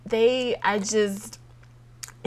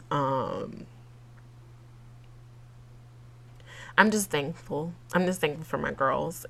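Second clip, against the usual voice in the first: slow, much softer, near tears; quiet tearful thanks; 3 semitones lower, 6 dB quieter, slower than usual. Real, not acted. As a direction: say it gently through held-back tears. Tearful thanks to her friends.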